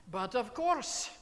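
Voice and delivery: loud, emphatic "But of course"; energetic response. Acted. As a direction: throw it out boldly, full voice. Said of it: "It's an energetic response to life".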